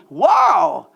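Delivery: loud, drawn-out wow; delighted wow. Retold or imitated. Guest showered with gifts in Tahiti, delighted wow. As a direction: exclaim it in showy amazement.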